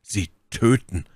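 slow, falling echo; stunned repetition. A narrator's reading. Felton repeats the realisation to Milady, slowly and stunned. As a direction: repeat it slowly as the horror sinks in.

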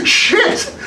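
a high, whooping laugh, then a burst of Shit; delighted laugh. Acted. Delighted at strange dream.

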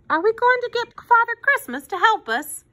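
put-on high, hopeful child's voice; little girl. A narrator's reading. Girl hopes Father Christmas will help, childlike. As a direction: ask in a high, wondering little voice. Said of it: "asked Susie".

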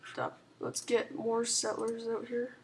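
usual speaking voice

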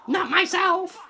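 high-pitched mock yell; mimicked shout. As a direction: parrot the film's outburst, pitched high. Echoes the film character's shout.

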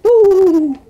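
loud high thrilled ooh; excited ooh. Real, not acted. Kid thrilled the ball spins, loud ooh. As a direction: whoop at the ball trick.

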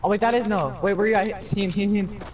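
high, loud callouts; frantic shouting. Real, not acted. Calling out enemy positions to squad.

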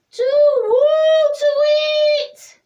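high, loud, cross hooting owl. A narrator's reading. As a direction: hoot it out crossly. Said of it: "said the owl crossly".

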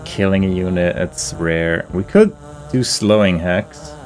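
ordinary talk, nothing marked

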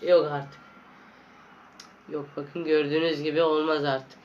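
usual speaking voice

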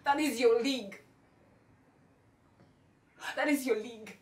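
loud, scornful put-down; contemptuous anger. Acted. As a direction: throw it at him with force. Acted contempt for cheating husband.

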